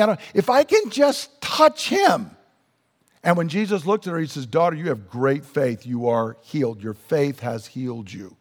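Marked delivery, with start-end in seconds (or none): 0.0-2.3 pleading woman. Retold or imitated. Woman pleading in retold story. 4.5-8.4 tender. Retold or imitated. Jesus speaking to healed woman.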